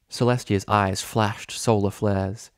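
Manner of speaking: usual speaking voice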